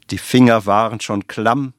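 ordinary delivery

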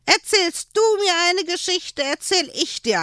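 put-on high, cheeky child bargaining; little boy striking a deal; sly grin. A narrator's reading. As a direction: say it with a crafty grin. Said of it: "grinste er".

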